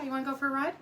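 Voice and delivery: high, rising teasing questions; enticing pet talk. Real, not acted. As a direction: tempt a dog with an outing. Enticing the dog with a ride.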